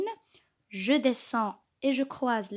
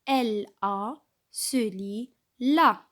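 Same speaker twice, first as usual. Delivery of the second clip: L A se lit la spelled out slowly; slow, deliberate classroom decoding; slow sounding-out; slower than usual. Shown as a demonstration. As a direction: spell la out letter by letter. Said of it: "Nous avons vu la syllabe la".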